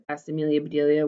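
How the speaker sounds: plain reading voice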